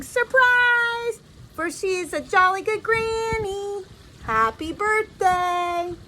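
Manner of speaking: high, drawn-out party cheer; crowd's birthday shout, stretched out; yelling surprise. Acted. Friends surprising granny on her birthday, joyful yelling. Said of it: "they're all yelling".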